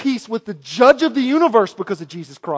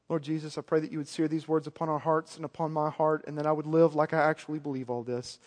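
much softer, hushed earnest prayer; 6 semitones lower, 12 dB quieter than usual. Real, not acted. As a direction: pray quietly and sincerely. Closing prayer.

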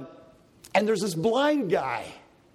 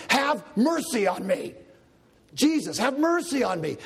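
pleading cry; 5 semitones higher than usual. Retold or imitated. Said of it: "he was saying".

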